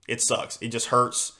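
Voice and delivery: usual speaking voice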